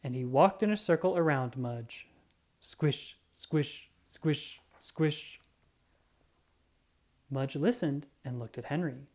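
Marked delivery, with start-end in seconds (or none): 2.6-5.4 squishing sound. A narrator's reading. Sound of wet sneakers.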